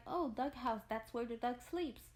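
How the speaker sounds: normal talking voice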